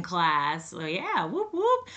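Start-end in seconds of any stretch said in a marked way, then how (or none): 1.3-1.9 high-pitched little cheer; celebratory whoop. Real, not acted. Podcaster cheering her class to listeners, high celebratory whoop.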